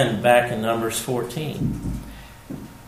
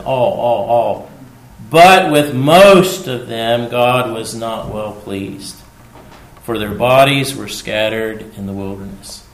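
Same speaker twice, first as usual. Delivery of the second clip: booming scripture reading; 6 dB louder than usual. Acted. Preacher reading Corinthians to the congregation, booming. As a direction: proclaim each "All" as a drumbeat.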